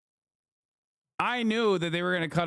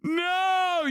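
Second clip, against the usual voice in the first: sudden high shout of horror; horrified no. Real, not acted. Reacting to his parents seeing the clip, shocked shout. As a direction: shout in real dismay at the clip.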